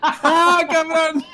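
high, loud laughing yelp; surprised outburst. Real, not acted. Outburst on hearing friend moved.

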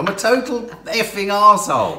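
loud, high mock declaration; mock shout. Real, not acted. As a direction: bellow it with comic defiance. Laughing mock outburst.

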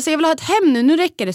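fed up. Retold or imitated. Mum telling how she reclaimed her home, fed up. Retold declaration that enough is enough.

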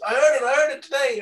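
high, loud excited call; excited brother. Retold or imitated. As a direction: burst out with the news. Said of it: "he always rings me up and he goes".

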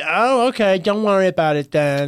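high, loud huffy retort; defensive. Retold or imitated. Donald backing off when told his host is busy, defensive. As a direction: imitate him getting loudly defensive. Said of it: "very defensive".

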